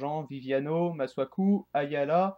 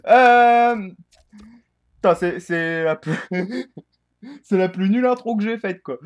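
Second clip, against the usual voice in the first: amused exasperation; 7 semitones higher, 12 dB louder than usual. Real, not acted. Laughing at his own bad intro.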